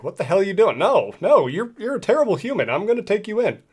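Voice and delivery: a hint of a hero's outrage, pushed up high; indignant hero. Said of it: "Zeal's like".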